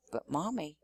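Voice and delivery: put-on soft, small child's protest; a touch sobby. A narrator's reading. Joey protests to Mommy, softly and a little sobby. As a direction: plead softly, on the edge of tears. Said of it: "starting to get all sobby".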